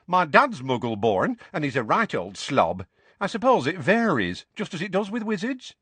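put-on high, lilting young witch voice; chatty young witch. Young witch to Harry about her Muggle-born dad, chatty.

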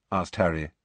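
ordinary delivery, nothing marked

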